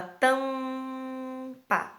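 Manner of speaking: "tampa" very slow, with the "tam" held long through the nose; nasal sound. Shown as a demonstration. Teacher contrasting "tampa" with "mala" for children, slow and nasal. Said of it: "ele treme".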